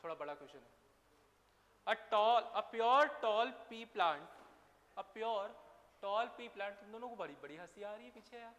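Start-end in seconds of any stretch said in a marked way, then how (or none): 1.9-4.2 each word spaced out slowly and loudly for copying; slow, loud dictating voice; slow dictation. Shown as a demonstration. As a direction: dictate it slowly so they can write. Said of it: "question लिख लो ये वाला".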